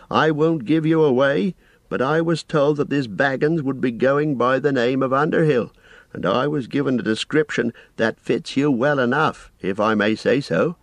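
a busy, apologetic innkeeper rambling on; fussy landlord. A narrator's reading. Landlord Butterbur voiced.

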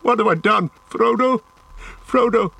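very high, anguished cry; desperate calling. A narrator's reading. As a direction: call out after someone who has fled. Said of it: "he called".

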